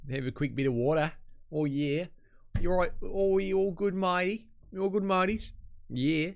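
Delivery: high, playful Australian put-on; Australian accent. Reader riffing on saying water, mock Australian. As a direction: slip into a matey Australian accent. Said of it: "I don't know why I went Australian".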